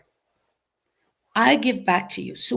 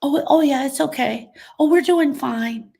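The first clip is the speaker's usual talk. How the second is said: raised, pretend-fine voice; put-on reassurance; 4 semitones higher, 4 dB louder than usual. Griever lying to people about doing fine, put-on reassurance. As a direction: act fine to cover the hurt, pitched up. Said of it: "we will tell people we're doing fine. We lie".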